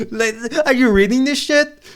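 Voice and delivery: high, loud disbelief; incredulous outburst. Real, not acted. Reacting to what he reads in the game, incredulous. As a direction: burst out in incredulous, high-pitched exasperation.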